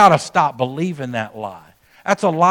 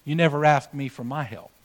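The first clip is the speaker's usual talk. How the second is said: daddy speaking quietly and gently; a father lowering his voice to teach; soft fatherly correction; 3 semitones lower, 9 dB quieter than usual. Retold or imitated. Daddy gently telling his son he never asked for help, quiet and tender. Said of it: "And his daddy said".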